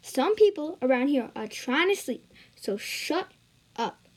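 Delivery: loud, irritated shout; angry yell. Acted. Sleeper yelling at noisy roommates, loud and irritated. Yells at roommates keeping others awake.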